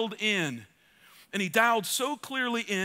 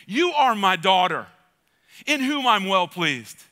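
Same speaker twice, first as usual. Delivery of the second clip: louder, slow and emphatic; proclaiming God's words; 3 semitones lower, 6 dB louder, slower than usual. Retold or imitated. Preacher voicing God to a daughter, loud proclamation. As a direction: declare it over her. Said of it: "hear God say to you".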